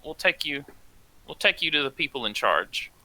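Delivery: usual speaking voice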